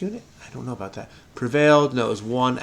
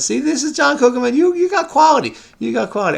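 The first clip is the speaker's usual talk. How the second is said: much higher, gleeful praise; excited; 12 semitones higher, 5 dB louder, faster than usual. Real, not acted. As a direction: crow it out in delight. Gloating to puzzle setter.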